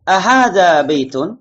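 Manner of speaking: "Ahadha baitun" as a drawn-out model question; question intonation. Shown as a demonstration. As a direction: lift "Ahadha" high as a slow question. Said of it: "Intonasi bertanya".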